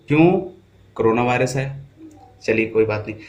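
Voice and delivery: ordinary delivery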